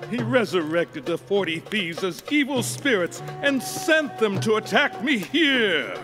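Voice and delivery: high-pitched, aggrieved account building in force; indignant complaint. Acted. King telling of the forty thieves' attack, indignant. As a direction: build the grievance to an outraged finish.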